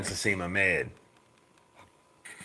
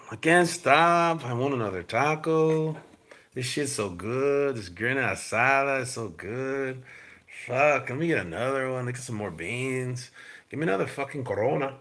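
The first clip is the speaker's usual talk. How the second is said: imitating Andy Ruiz eating; 3 semitones higher than usual. Mocking Andy Ruiz at the taco table, lightly voiced. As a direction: play a man who can't stop eating tacos. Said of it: "Andy Ruiz eating like no tomorrow".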